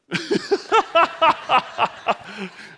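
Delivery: loud high burst of laughter; delighted laugh. Real, not acted. Preacher laughs watching Phil juggle, bursting out laughing.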